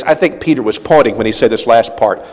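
ordinary talk, nothing marked

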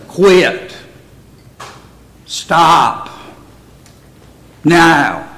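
firm one-word commands, each set apart; emphatic advice. Acted. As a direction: urge them firmly, pausing after every word. Gives imagined smoker blunt advice.

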